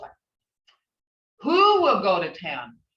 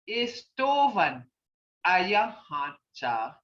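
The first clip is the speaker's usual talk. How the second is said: Istovan ayahancha said slowly, pausing between the two words; "Istovan" lifted, then a pause, then "ayahancha" drawn out and falling; slow teaching model; slower than usual. Shown as a demonstration. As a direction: break "Istovan" and "ayahancha" apart and say each slowly, as a teacher does. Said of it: "teacher modelling phrase slowly".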